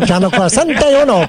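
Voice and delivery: rapid-fire mock Spanish TV announcer; fluent Spanish announcer. Host imitating the Spanish channel announcer, fast mock announcer voice. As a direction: rattle it off like a Spanish station ID. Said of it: "such a fluent language and flying".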